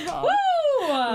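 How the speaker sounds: high, loud laughing wow; excited whoop. Real, not acted. Friends cheering and laughing together, whooping.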